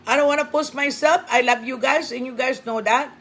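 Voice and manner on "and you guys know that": usual speaking voice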